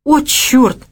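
sudden cursing outburst, pitch jumping up; loud outburst. A narrator's reading. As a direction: blurt it out, unable to hold back. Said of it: "не удержался и громко бросил".

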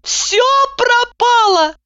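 very high, slow wail; lamenting wail. A narrator's reading. Heroine laments in the yard. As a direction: wail in despair. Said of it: "запричитала".

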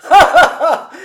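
high, loud "ha ha ha"; ringing burst of laughter; loud acted guffaw. A narrator's reading. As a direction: burst into a hearty stage laugh. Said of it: "en riant aux éclats".

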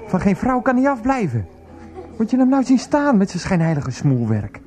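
high-pitched, scornful outrage; indignant rant. Acted. Rants about the hated colleague. (verontwaardigd tekeergaan)

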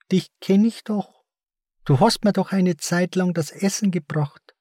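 high-pitched voice of someone recognising a visitor; high, put-on speaking voice; character voice. A narrator's reading. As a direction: pitch it far up, as another character. A character suddenly addresses Martin.

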